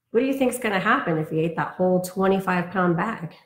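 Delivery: lively storybook read-aloud; reading to children. Acted. Teacher reading a picture book to her class, in a lively storybook voice. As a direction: read it like bedtime story time for kids.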